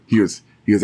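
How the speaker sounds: ordinary delivery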